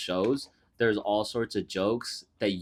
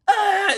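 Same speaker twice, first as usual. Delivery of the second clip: mock wail; 7 dB louder than usual. Retelling the assistant crying out.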